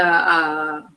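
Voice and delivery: normal talking voice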